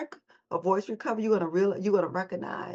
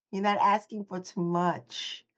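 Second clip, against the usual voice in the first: ordinary talk, nothing marked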